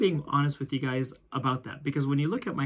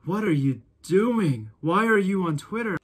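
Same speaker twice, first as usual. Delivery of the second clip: diabolical angry boss; 5 semitones higher, 6 dB louder than usual. Retold or imitated. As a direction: boss losing his temper. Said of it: "It was diabolical".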